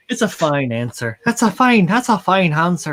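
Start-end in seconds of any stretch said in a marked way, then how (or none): 1.2-2.9 put-on higher-pitched playful Irish accent. Riffing with a friend on the answer, mock Irish accent. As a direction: ham up a lilting Irish brogue. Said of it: "can you do an Irish accent?".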